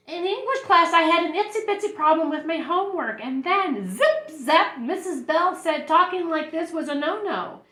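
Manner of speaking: put-on high-pitched little girl explaining; high schoolgirl voice telling her story; schoolgirl Lulu. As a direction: pitch it up, earnest and childlike.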